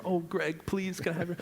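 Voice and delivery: high, soft, whiny begging voice; mock pleading beggar. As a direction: plead like you're apologizing for asking. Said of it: "like I'm apologizing for asking".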